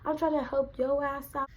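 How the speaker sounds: high, heated yelling retort. Retold or imitated. Worker raising her voice back at her manager. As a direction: re-enact yelling back, pitch way up. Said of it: "So I raised my voice at her".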